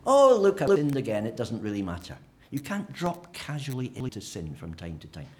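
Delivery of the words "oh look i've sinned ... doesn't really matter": careless believer. Retold or imitated. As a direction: toss it off lightly. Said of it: "you can't say".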